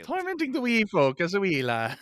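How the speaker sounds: lightly voiced Irish lilt; a hint of a leprechaun brogue; Irish accent. Continues Irish teasing.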